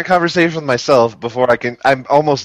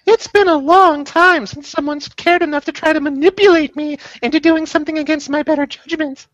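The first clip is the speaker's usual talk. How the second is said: put-on high girl voice. Voicing Trini.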